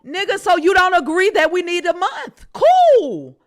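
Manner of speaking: loud, high, exasperated; angry outburst. Real, not acted. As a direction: snap back at him, fed up. S1 snaps back in argument.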